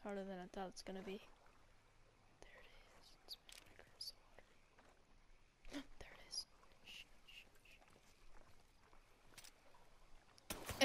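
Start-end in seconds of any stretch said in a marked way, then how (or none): 2.4-10.9 barely voiced breathy whisper. Real, not acted. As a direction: hush so it won't hear. Hushed stalking, gasp, shushing.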